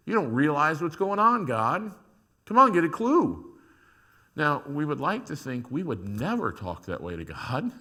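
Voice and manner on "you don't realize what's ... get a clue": exasperated scolding. Acted. Voicing Israel scolding God.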